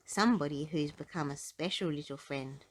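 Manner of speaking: ordinary delivery, nothing marked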